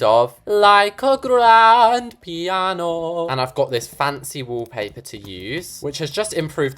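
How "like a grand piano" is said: high, loud and drawn out; grandiose. Pretend. Picturing a grand piano in the royal room, grandly. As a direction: savour it like a grand dream.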